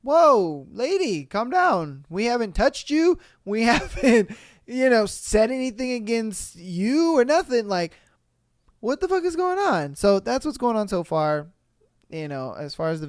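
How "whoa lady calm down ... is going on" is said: higher-pitched, incredulous mock response; acted-out disbelief at an overreaction; baffled protest. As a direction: plead with her, bewildered, voice swooping up. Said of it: "And it's like".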